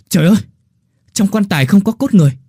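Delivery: shrill, loud frightened shout; panicked cry. Acted. Digger shouts up to the villagers in panicked horror. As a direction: cry out in horror at the empty coffin. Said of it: "hoảng hốt kêu lên".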